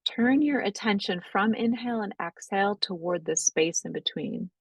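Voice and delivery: calm, unhurried breathwork guidance; guided meditation. Acted. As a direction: ease the class gently into noticing the breath. Leads a guided breathing practice.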